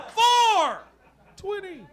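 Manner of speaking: squeaky high four twenty; high punchline. Acted. Comic answering cops, four twenty sung out high. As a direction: belt out the number way up high.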